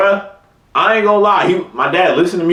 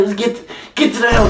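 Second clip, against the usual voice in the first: higher, fast scolding mom; mad mom impression; 9 semitones higher, faster than usual. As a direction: rush it out like an angry mom. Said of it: "I can't really impersonate it for real".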